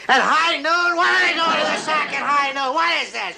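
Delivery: high indignant squawk; flabbergasted at the sleepers; captain sputtering at men in bed; outraged disbelief. Acted.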